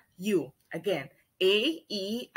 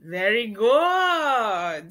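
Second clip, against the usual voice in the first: sing-song praise for the class; drawn-out praise; 4 dB louder, much slower than usual. Real, not acted. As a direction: stretch it out slowly and louder.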